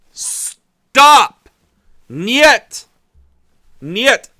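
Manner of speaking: high, slow, loud plea; pleading shout. Acted. Vlogger yelling a plea at Russia, loud and slow. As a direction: cry out at Russia, slowly.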